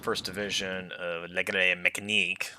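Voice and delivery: hammy mock French accent on the unit name; terrible French accent. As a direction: put on a bad French accent for Legere Mécanique. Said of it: "a terrible French accent".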